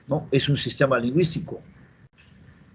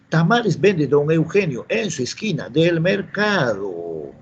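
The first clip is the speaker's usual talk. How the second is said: slow, drawn-out Amazonian charapa lilt; charapa accent; 3 semitones higher, slower than usual. Acted. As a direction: imitate a charapa's sing-song Peruvian jungle Spanish, stretching the last word. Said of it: "Y el charapa le dice". (acento charapa cantado)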